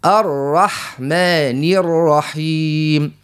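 الرحمن الرحيم with one light tap on the r, slow. Shown as a demonstration. Teacher shows a light r, slow and clean. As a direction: do not roll the r of الرحمن. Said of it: "ما نعملوش برشا تكرير".

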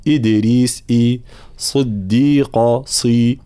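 slow demonstration, long clean i in "إدريس" and "صديقة"; fully realised kasra. Shown as a demonstration. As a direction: isolate the vowels "إي" and "صي" with a true i. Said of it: "تحقيق حركات الكسر".

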